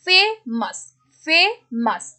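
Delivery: Famous broken as फे, pause, मस; teacher separating फे from मस; split into syllables. Shown as a demonstration. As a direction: say famous in two separate beats. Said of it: "दो syllable हो गए".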